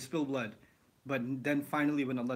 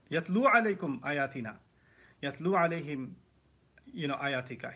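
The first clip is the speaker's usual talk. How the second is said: fast, louder recited Arabic; Quranic recitation; 5 dB louder, much faster than usual. Shown as a demonstration. Teacher reciting verses to show reading. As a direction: chant the verse quickly like a recitation.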